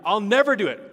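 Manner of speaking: loud, high-pitched insistent refusal; vehement vow. Retold or imitated. Peter swears to Jesus he won't deny him, vehement vow. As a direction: cry out a cocksure denial.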